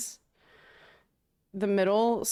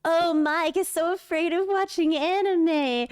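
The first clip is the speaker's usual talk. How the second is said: higher-pitched, theatrical taunt; mean mockery; 5 semitones higher than usual. Mocking Mike about watching anime, mean stage taunt. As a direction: tease him with a hammed-up jab. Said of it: "Mike told me to be really mean".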